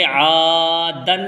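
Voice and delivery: عادن with the first vowel stretched very long; drawn-out aa. Shown as a demonstration. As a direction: pull out the opening vowel of عادن slowly. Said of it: "تو یہاں ہم اس کو کھینچ کر کے پڑھیں گے".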